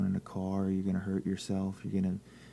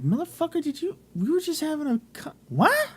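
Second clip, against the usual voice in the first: very high mock-incredulous protest; exasperated retort; 4 dB louder than usual. Retelling a phone chat with his oblivious friend, high exasperated protest. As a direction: squeal the complaint in comic disbelief.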